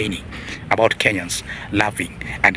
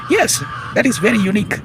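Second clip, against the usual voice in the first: much higher and louder, triumphant answer; emphatic exclamation; 12 semitones higher, 3 dB louder than usual. Real, not acted. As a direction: cry it out as if proving the point. Rhetorical question then emphatic answer.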